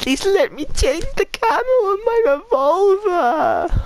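very high, loud whiny squeal; squealing mock whine. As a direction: wail it in a squeaky, pleading voice. Mock whining at the game.

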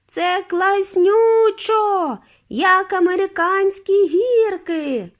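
high, loud, slow delighted yell; thrilled shout. A narrator's reading. Charlie shouting to grandpa, high and thrilled. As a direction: shout with glee over the rush. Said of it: "Чарлі у відповідь крикнув".

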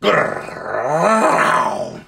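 low animal growl. A narrator's reading. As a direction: growl it like a beast. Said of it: "all Uncle Andrew heard was".

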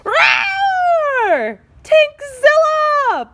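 hammed-up monster roar. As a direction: bellow like a giant beast. Hamster wakes as monster.

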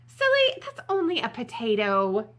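playful storybook dismissal; scoffing correction. Acted. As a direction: chide a silly friend. Correcting the panicked friend.

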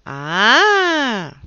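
long, rising-falling loud "aah!"; drawn-out exclamation of threat; menacing loud "aah". A narrator's reading. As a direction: let out a big, loud "aah". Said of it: "făcu Achim, scuipând în palme".

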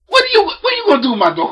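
high, fast, hammed-up panic; comic frantic rant. Dad ranting at the daughter's date, high and fast. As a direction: spill it out in a frenzy.

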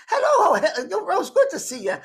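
high, loud mock-delighted woman; greeting sister. Dead sister greeting the lady on arrival, high loud put-on voice. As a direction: imitate a sister's hearty high-pitched hello. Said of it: "my sister, who's been dead".

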